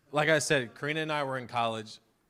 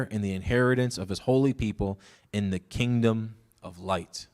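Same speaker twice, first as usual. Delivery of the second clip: louder, measured scripture read; 5 dB louder than usual. Acted. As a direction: proclaim the verses to the congregation. Reads scripture passage aloud.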